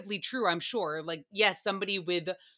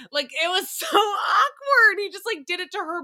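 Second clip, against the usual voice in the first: laughing shout; 10 semitones higher, 7 dB louder than usual. Real, not acted. Retelling the awkward raspberry scene, high laughing shout. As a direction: cry out the words mid-laugh.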